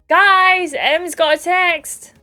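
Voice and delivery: loud, high call, drawn out slowly; yells. Acted. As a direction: call the whole villa over, slow and loud. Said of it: "He yells".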